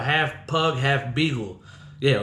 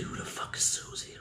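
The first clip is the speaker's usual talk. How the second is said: hushed, voiceless whisper; scared inner voice, barely audible; whispered thought; 14 dB quieter than usual. Retold or imitated. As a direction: whisper it to yourself in bewilderment. Scared thoughts while creeping down.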